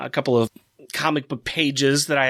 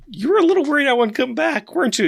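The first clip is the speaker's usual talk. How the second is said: teasing; 10 semitones higher than usual. Retold or imitated. Teasing Walt.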